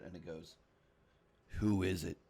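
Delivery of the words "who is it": a hint of a gruff voice; lightly voiced gruff stranger. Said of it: "gruff voice on the other side".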